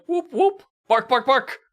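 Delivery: imitated sound effects. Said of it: "he's just going like".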